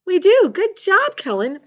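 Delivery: high, loud burst of praise; excited praise. Real, not acted. Teacher praising Kellen's right answer, excited and loud. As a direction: cheer the pupil on for getting it.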